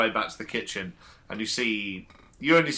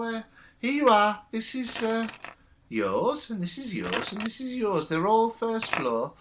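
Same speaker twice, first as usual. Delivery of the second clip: put-on high, thin old lady voice; fussy elderly landlady showing rooms; very old woman; 10 semitones higher than usual. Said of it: "June sort of comes in and goes".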